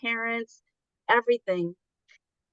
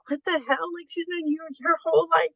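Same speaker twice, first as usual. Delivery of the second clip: incredulous sister; 8 semitones higher than usual. Retold or imitated. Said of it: "even her sister was like".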